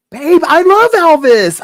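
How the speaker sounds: loud, high, drawn-out gushing; gushing enthusiasm. Retold or imitated. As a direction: oversell it, loud and high, to win someone over. Acted eager lie to the tattooist.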